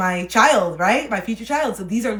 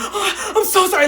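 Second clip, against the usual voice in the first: high, loud mock sobbing apology; hammed-up tearful sorry; breaking down; 12 semitones higher, 8 dB louder than usual. Imitating a crying driver who tapped her car. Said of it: "like, breaking down".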